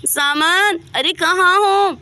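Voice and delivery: mock shout for someone missing; calling out. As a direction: call the name out through the house. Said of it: "क्यों इतनी तेज तेज चिल्ला रही हो".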